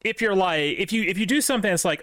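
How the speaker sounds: ordinary talk, nothing marked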